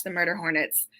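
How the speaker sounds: normal talking voice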